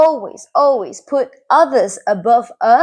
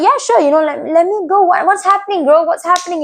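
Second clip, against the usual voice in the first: raised pitch, anxious to help; mildly acted, higher-pitched eager concern; 6 semitones higher, faster than usual. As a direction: jump in as the ever-ready friend. Acts out rushing to help friend.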